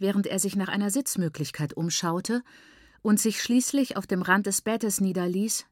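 ordinary delivery

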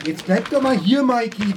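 high and loud, pleading call; loud plea to stay. Acted. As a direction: call him back. Calling after Mike leaving.

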